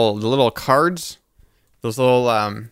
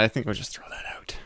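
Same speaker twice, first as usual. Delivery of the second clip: whispered aside; 12 dB quieter than usual. Real, not acted. Aside whispered near the mic.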